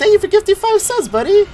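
very high, loud cartoon thanks; Goofy. Streamer thanking a viewer for gifted subs, Goofy impression. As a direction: gush thanks in a goofball cartoon voice. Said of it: "I gotta talk like Goofy".

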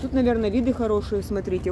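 ordinary talk, nothing marked

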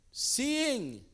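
"seeing" drawn out high and loud; stress on seeing. Real, not acted. Preacher quoting the Bible to church, "seeing" high, loud, stretched. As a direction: punch "seeing" loudly and hold it high. Emphatic preaching on God looking.

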